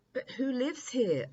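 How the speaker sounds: put-on a young prince asking in wonder; curious prince. Prince asks the dreams.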